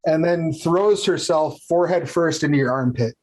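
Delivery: ordinary delivery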